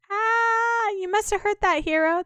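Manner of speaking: squeaky high game-character voice. Acted. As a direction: play a young sidekick calling to the hero. Character greets the hero.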